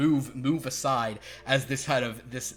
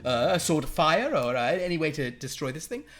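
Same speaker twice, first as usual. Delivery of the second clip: posh fae voice; 3 semitones higher, 4 dB louder than usual. Acted. Galliard pleads with Rena.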